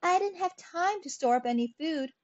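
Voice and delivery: high-pitched whining excuse. A narrator's reading. Grasshopper excusing himself to the ants, whining. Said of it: "whined the grasshopper".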